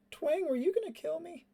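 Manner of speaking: small, high, hurt voice; plaintive. Acted. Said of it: "it's plaintive".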